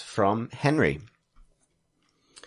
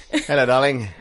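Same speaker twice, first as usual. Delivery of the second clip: louder, put-on Victorian husband greeting; Victorian man; 3 semitones higher, 6 dB louder than usual. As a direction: greet your wife as a hammy Victorian gentleman. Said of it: "your go-to voice of pretending to be a Victorian man".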